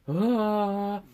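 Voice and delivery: high, loud imitated battle yell; war cry. Rafiki shows up to fight, mock war cry. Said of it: "shows up with a war cry".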